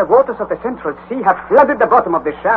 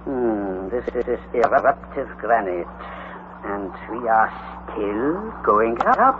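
lower, softer, slow musing; muttering; 9 semitones lower, 8 dB quieter, much slower than usual. Acted. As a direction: mutter to yourself while probing rock. Uncle muttering, examining walls.

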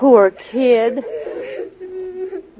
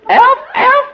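high, loud elf squeak; 11 semitones higher, 6 dB louder than usual. Pretend. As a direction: yelp it out like a silly elf. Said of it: "Prove it, George".

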